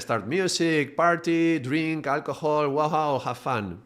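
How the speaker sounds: high, loud, rowdy, slowing at the end; rowdy party call. Retold or imitated. Ego calls his mates to party, loud and high. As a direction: call the crew to party once the boss is gone. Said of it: "he's gone".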